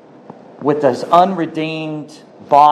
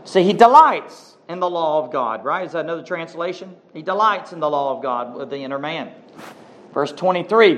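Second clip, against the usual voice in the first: ordinary delivery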